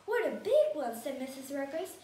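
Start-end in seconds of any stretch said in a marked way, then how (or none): 0.1-0.9 loud, excited storybook exclamation; impressed exclamation. A narrator's reading. Missus Ruggers admiring the fish just caught, impressed. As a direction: exclaim over the size of it.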